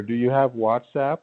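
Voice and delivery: usual speaking voice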